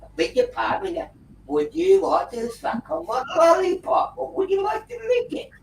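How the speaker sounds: high, hammed-up indignant squeal; mock outraged policewoman. Retelling the policewoman's outraged reply to his joke, high mock shriek. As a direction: shriek it back at him in mock disgust. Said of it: "she's like".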